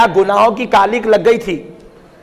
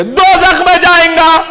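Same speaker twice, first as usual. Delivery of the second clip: high, emphatic cry imitating a group's answer; mock-quoted verdict, pitched high; scholars' verdict; 8 semitones higher than usual. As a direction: call it out as the clerics' ruling. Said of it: "پورے دیوبند کے علماء بولیں گے".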